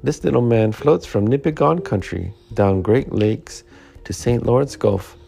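bursting with French-Canadian excitement; overjoyed. Acted. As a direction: read the news out in wild delight. Lumberjack overjoyed about paper.

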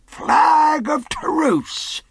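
very high, long-drawn holler; loud cry. A narrator's reading. Buccaneer answers the captain's challenge, drawn-out cry. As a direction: holler it out slowly across a distance. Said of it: "cried Silver".

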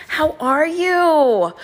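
high, slow, drawn-out greeting; sing-song greeting. Acted. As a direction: lilt it like a host.

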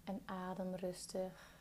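calm meditation guide, long pauses between phrases; guided meditation. Real, not acted. Guiding a listener through a body scan, calm meditation voice.

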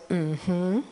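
low, muted hum of assent; small closed-mouth mm-hmm; curt mm-hmm. Retold or imitated. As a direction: imitate a reserved, wordless yes. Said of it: "Just like that".

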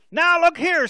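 loud, high-pitched angry outburst; heated retort. Acted. Irritated man answering a repeat wrong-number call, heated. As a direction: snap back hotly at the caller. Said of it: "said the heated reply".

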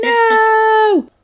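high, loud mock "No!"; quoting a character's dismay; mock cry of no. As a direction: squeal the no in fake despair. Reacting to arcade machine scene.

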